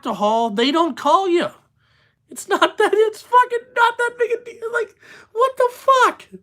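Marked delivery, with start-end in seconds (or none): 2.3-6.3 exasperated high-pitched rant. Real, not acted. Ranting about Brian's non-compete.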